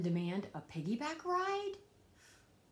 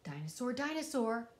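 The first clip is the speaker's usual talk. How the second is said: usual speaking voice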